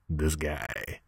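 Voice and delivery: low, quiet announcer-style punchline; deep gag voice. Acted. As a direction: drop into a low deadpan "this guy".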